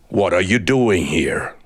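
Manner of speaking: low, steady voice of a great eagle; deep eagle voice. A narrator's reading. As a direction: speak as the proud eagle from above.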